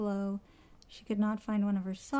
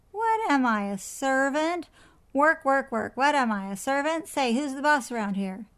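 loud, high, indignant parrot voice; noisy put-upon parrot complaint; yelling parrot; 4 semitones higher, 7 dB louder than usual. A narrator's reading. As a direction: squawk it louder, hammering each word. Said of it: "bounced around yelling".

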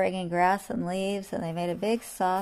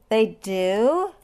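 surprised; 3 semitones higher, 7 dB louder than usual. Acted. Lion puzzled.

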